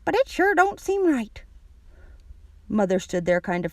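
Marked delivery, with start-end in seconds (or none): 0.0-1.4 put-on high, disapproving old lady; high-pitched granny; grandma voice. A narrator's reading. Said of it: "said the grandma".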